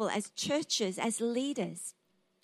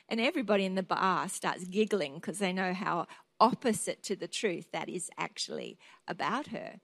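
ordinary talk, nothing marked

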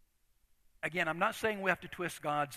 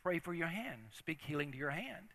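quiet inner voice; 8 dB quieter than usual. Retold or imitated. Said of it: "I hear this voice say".

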